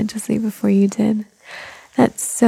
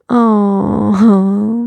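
surprised gasp; 5 dB louder than usual. Acted. Baby wakes as she sneaks.

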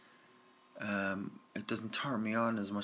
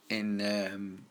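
ordinary talk

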